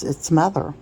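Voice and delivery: ordinary delivery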